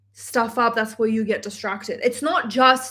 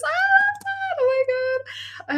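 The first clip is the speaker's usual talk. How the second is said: much higher, gushing squeal; delighted squeal; slower than usual. Real, not acted. Reading a fan's message, she squeals with delight.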